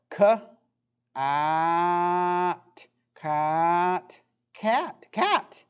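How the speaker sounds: "K, at" said as separate stretched sounds, then "cat" rising; drawn out vowels. Shown as a demonstration. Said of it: "Let's do another one".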